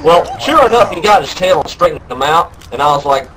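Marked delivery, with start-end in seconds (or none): none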